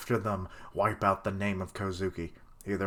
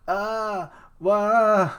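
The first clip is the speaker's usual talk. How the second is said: mock wail; 11 semitones higher, 6 dB louder than usual. Mimicking characters crying.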